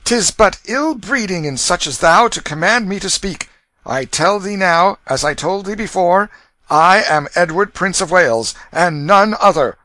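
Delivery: put-on high, loud, haughty boy prince; indignant young prince. A narrator's reading. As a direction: declare it with royal indignation. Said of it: "steady and indignant gaze".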